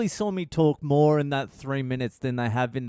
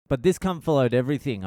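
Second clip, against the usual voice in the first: usual speaking voice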